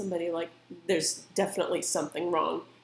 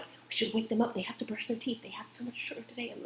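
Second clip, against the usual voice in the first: hushed arguing; 4 semitones higher, 8 dB quieter than usual. Retold or imitated. Parents arguing quietly at night.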